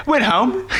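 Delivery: put-on British accent slipping into Australian; attempted British accent. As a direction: try British, land in Australian. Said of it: "it's going to be Australian".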